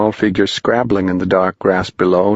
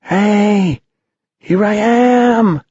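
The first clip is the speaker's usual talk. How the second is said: put-on much higher, slow, distant little boy's call; frail call; 13 semitones higher, 4 dB louder, much slower than usual. Pipkin calling from across the ravine, high and frail. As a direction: call out small and far away. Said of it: "called a frail voice".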